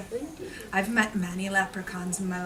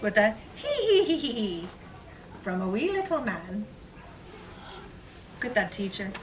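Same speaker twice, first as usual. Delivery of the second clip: lively story reading; 2 semitones higher, 5 dB louder than usual. A narrator's reading. Teacher reading the rhyming book aloud to children. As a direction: read it with storybook sparkle.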